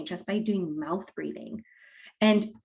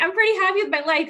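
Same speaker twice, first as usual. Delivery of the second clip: high, quick, laughing; 12 semitones higher, 7 dB louder, faster than usual. Real, not acted. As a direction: laugh it out, pitched up. Laughing retort, pitch high.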